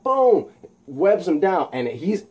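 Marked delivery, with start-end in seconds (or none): 0.0-0.5 high punched-out "boom"; comic-book punch sound effect; impact boom. Acted. Voicing a blow's impact.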